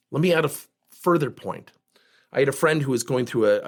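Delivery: usual speaking voice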